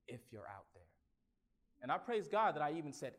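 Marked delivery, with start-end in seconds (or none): 0.1-0.8 barely audible, trembling plea to God; a broken man's faint plea; hushed prayer. Retold or imitated. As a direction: whisper it like a desperate first prayer. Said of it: "I said".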